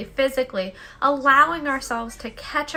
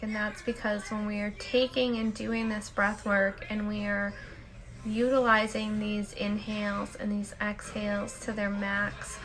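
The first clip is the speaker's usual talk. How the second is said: guided meditation; 4 semitones lower, 6 dB quieter than usual. Acted. Host leading listeners in the daily peace break, soft meditation voice. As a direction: guide them into stillness, unhurried and hushed.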